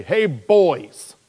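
hearty hail. Acted. Preacher walking in to address the men, hearty loud hail.

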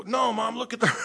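high, loud urging to mom, near laughter; urgent retold plea. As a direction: plead loudly with mom, amused.

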